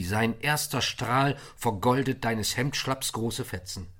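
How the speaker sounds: plain reading voice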